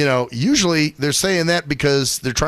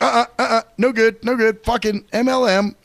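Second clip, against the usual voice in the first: dismissive refusal; 8 semitones higher than usual. Retold or imitated. Mock refusal of MLM pitch.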